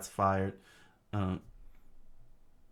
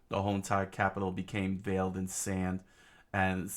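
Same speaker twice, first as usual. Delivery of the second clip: ordinary delivery, nothing marked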